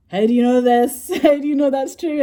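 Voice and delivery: high, loud astonished outburst; amazed disbelief. Retold or imitated. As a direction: blurt it out, incredulous and loud. Amazed retold reaction to him.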